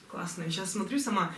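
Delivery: ordinary talk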